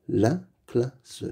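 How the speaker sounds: La classe split into la, cla, sse; syllable by syllable. Shown as a demonstration. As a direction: sound out "La classe" with gaps between syllables. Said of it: "Lis les mots".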